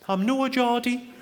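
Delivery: high, slow mock Sunderland accent; terrible Geordie accent. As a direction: badly imitate an indignant Geordie-sounding barmaid. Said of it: "Sorry, that's a terrible accent".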